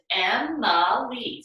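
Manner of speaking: "Emma Lee" drawn out in three beats; split into syllables. Shown as a demonstration. Teacher showing children the syllables in her name, "Emma Lee" syllable by syllable. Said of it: "I have three syllables in my name".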